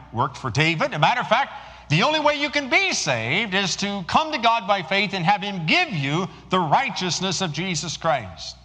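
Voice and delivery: high, loud preaching at full force. Acted. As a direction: proclaim it to the whole congregation.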